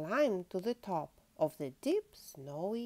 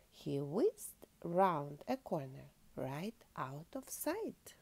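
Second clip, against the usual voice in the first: plain reading voice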